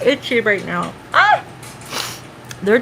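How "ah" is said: sudden high cry; sharp high ah. Real, not acted. Itchy nose, sudden high yelp.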